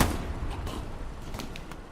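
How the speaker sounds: soft, breathless chuckling fit; quiet laughter. Real, not acted. Commentator still amused at his own flub, chuckling. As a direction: let the leftover giggles shake out.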